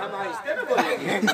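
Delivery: whispered with a little laugh; hushed giggling whisper. Shown as a demonstration. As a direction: breathe it out softly, no voice. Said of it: "एक बार तो आहिस्ते से बोल दीजिए".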